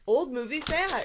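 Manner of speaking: loud, punchy tagline finish; announcer tagline. Acted. Co-host finishing the show's slogan, loud announcer punch. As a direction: bark the second half of the slogan.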